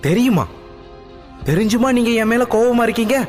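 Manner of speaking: high, quick astonished question; surprised. Acted. Daughter baffled her father knew, high surprised. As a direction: sound baffled, voice jumping up. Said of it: "வியப்படைந்தாள்".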